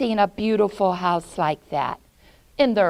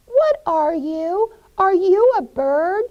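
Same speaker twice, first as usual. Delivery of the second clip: put-on high, loud kid voices; children questioning a strange owl; curious children; 11 semitones higher, 7 dB louder than usual. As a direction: ask the owl with wonder.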